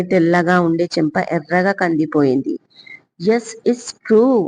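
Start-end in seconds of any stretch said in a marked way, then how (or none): 3.3-4.3 slow, higher, a little proud; hint of pride, higher and slow; a bit proud. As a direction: say it slowly like a small boast. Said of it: "కాస్త గర్వంగా".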